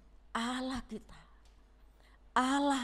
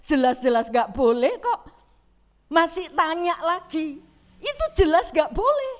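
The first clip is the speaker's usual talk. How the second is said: laughing; 5 semitones higher, 6 dB louder than usual. Real, not acted. Preacher laughs at foolish question.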